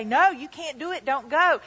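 loud, high scolding refusal; a preacher voicing deception, loud and high; deception's voice. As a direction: shout them down like a tempter. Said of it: "the deception will say".

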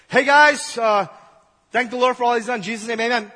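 rally the people with a loud amen; Moses calling out. Retold or imitated. Moses calls out loudly to the people, giving thanks. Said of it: "Okay, God, pretty cool".

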